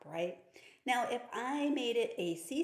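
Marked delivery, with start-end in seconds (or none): none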